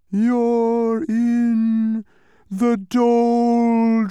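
an unseen voice wailing at Milo from far away; distant wail. A narrator's reading. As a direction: stretch the doldrums into a long eerie wail. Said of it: "wailed a voice that sounded far away".

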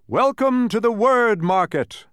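high, loud, slow announcement; stretched-out fanfare welcome; banner proclamation. A narrator's reading. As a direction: proclaim it grandly to a crowd.

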